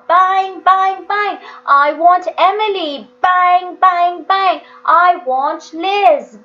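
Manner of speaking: slow, high, loud cries into the dark; desperate shouting. A narrator's reading. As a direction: howl it out, letting all the tightness go. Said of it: "He shouted into the darkness".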